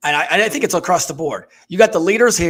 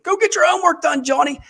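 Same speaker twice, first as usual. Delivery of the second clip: high nagging parent voice, pitched way up; mock nagging parent; 12 semitones higher than usual. Parent nagging Johnny about homework, high mock nag. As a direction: nag like a fed-up parent at a kid. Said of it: "Because you're yelling at them".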